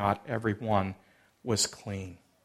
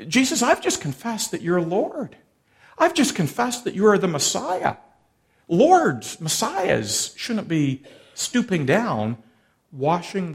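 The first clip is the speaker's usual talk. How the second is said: a higher, indignant imitation of Peter, lightly voiced; protesting Peter; 5 semitones higher, 3 dB louder than usual. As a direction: protest like a disciple who feels slighted, mildly. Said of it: "I see Peter saying".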